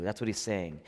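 normal talking voice

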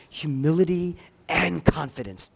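ordinary delivery

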